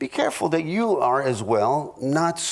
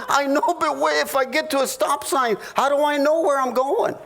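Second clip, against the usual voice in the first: higher, laughing mock plea; higher, hammed-up frustrated husband; pleading husband; 9 semitones higher than usual. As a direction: press her for a destination, pitched up and laughing. Husband's side of mock argument.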